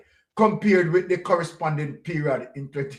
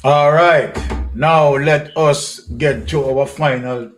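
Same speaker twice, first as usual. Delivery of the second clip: booming, slow host call to order; loud announcing; 2 semitones lower, 9 dB louder, slower than usual. Real, not acted. Host opening the last document for viewers, booming slow announcement. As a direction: boom it out to open the segment. Host launching the final segment.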